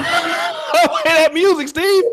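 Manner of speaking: very high, drawn-out wail; comic urgent demand. Real, not acted. Co-host begging for music over a caller, high comic wail.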